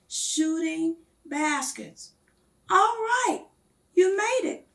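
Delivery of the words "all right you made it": higher, louder friendly call across the yard; excited shout across a schoolyard. A narrator's reading. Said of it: "called Carl".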